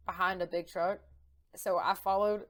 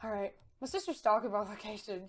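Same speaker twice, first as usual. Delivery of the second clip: normal talking voice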